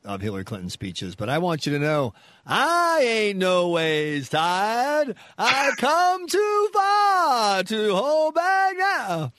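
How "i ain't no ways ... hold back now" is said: slow, high, loud hammed-up preacher cadence; mock rally preaching. Host parodying a Clinton speech for the guest, slow loud preaching. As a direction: belt it out like a rousing stump speech.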